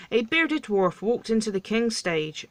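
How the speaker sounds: ordinary delivery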